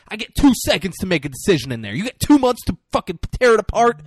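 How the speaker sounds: loud, fast, high-pitched mock rant; heated tirade. Renner going in on his boss, heated tirade. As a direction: go in on the boss, voice climbing.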